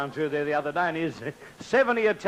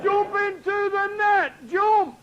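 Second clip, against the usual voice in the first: high, slow shouted call to jump into the net; firemen shouting; 14 semitones higher, slower than usual. Acted.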